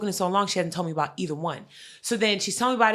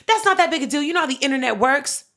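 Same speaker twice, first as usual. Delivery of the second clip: mock dismissive mom; 6 semitones higher, 6 dB louder, faster than usual. Mimics mom's dismissal.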